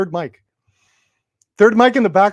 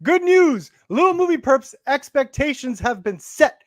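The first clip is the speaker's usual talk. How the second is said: segment announcer; 5 semitones higher than usual. Pretend. Host reading the good-news headline, announcer voice. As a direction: proclaim it like big news.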